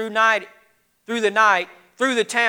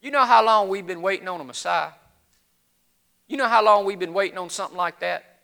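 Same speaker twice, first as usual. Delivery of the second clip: lower, softer, weary doubt; subdued, unconvinced night clerk; skeptical shopkeeper; 6 semitones lower, 6 dB quieter than usual. Acted. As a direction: gently dismiss the wild claim. Doubting shopkeeper answers excited shepherd.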